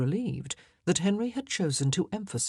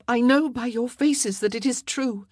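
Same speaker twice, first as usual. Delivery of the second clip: higher, louder pronouncement; firm, raised-voice certainty; knowing queen; 7 semitones higher, 5 dB louder than usual. Acted. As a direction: tell them you see through their silence. Queen confronts her silent ladies.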